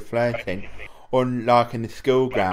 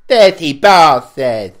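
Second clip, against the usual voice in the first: slow, loud and outraged, hammed up; mock outraged shout; 3 semitones higher, 11 dB louder, slower than usual. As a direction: hurl it at him slowly like a mock insult.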